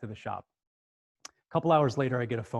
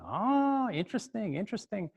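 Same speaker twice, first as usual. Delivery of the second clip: put-on New York accent; 8 semitones higher than usual. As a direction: muse like a New York detective. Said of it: "this kinda New York accent".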